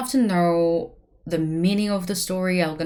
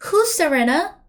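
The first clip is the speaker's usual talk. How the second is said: high, louder asking who's Serena; mock newcomer asking; 8 semitones higher, 6 dB louder than usual. Stranger entering the room calling for Serena, mock question. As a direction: call out like a stranger in the doorway. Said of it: "Somebody came into our room and say".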